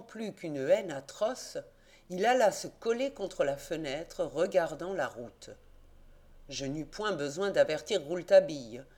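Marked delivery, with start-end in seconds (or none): none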